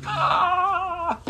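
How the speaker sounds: high, delighted mock exclamation; a thrilled little shout at the thought of money; imitating someone lighting up over wealth; mock excited cry. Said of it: "they're like".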